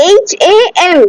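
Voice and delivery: usual speaking voice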